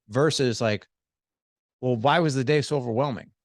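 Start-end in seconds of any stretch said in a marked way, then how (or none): 1.8-3.3 mildly challenging, higher-pitched question; a touch of blame; accusatory. Acted. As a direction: put them on the spot a little. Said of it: "it's accusatory".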